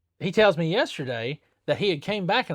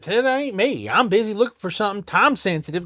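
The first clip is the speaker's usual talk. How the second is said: higher, louder defensive denial; retold protest; 7 semitones higher, 4 dB louder than usual. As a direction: insist it wasn't you. Denying to coworker Amber.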